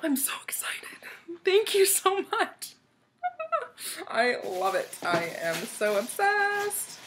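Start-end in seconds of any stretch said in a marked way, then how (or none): 0.0-2.7 whisper to squeal. Real, not acted. Giddy whisper then squeal. 3.2-4.0 squeaky high laugh; high squeal of laughter; high giggle. Real, not acted. Giddy laugh.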